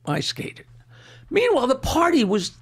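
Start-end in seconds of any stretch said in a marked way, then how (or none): 0.0-0.7 whispered, under the breath; relieved whisper. Retold or imitated. Comic thinking he got away with it, whispered relief. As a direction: mutter it to yourself in relief. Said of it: "And I'm like".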